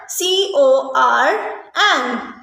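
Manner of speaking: usual speaking voice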